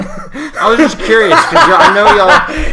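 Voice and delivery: high, loud and laughing; squealed explanation, cracking up; laughing protest. Real, not acted. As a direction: blurt it out through laughter. Defensive question amid shared laughter.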